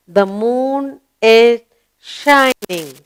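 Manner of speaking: slow, stretched-out sentence reading; slow model reading. Shown as a demonstration. Teacher reading the moon sentence to pupils, slow model reading. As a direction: draw out each word for young learners.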